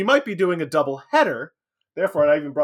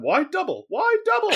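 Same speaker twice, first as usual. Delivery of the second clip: mock curious customer. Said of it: "We get asked all the time".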